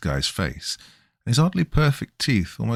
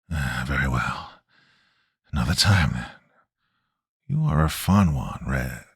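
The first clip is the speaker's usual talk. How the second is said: raspy chain-smoker voice; 7 semitones lower than usual. A narrator's reading. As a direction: rasp it out like a lifelong smoker. Stranger's raspy voice.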